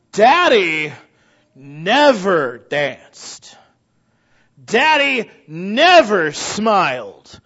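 loud, drawn-out scolding complaint; preacher voicing a bitter wife, drawn out and loud; mock scolding. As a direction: belt it like a sulky accusation.

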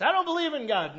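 high, loud mock denial; mocked atheist. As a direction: blurt it out as a caricatured atheist. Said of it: "Even the atheists".

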